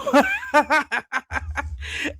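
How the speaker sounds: bursting into a high, rising laugh; real laugh breaking through. Real, not acted. Laughing at her own story.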